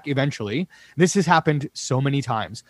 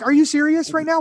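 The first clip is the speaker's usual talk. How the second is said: incredulous; 12 semitones higher than usual. Retold or imitated. DJ retorts to pushy guest.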